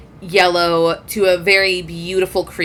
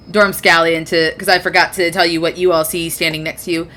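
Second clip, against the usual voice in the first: usual speaking voice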